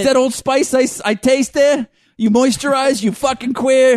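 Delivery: put-on high, loud Boston-accent taunt; mock Boston tough. Licker tasting Old Spice on rival's face, loud mock taunt. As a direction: jeer in disbelief as a Boston brawler.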